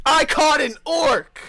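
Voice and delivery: loud, high triumphant shout; I caught it, shouting with glee; excited shout. Real, not acted. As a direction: yell it out like a proud hunter. Player brags about catching orc.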